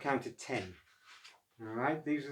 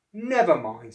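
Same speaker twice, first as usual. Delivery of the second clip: reassuring; 3 semitones higher, 9 dB louder, slower than usual. Acted. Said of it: "said Oscar".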